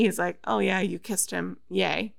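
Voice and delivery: usual speaking voice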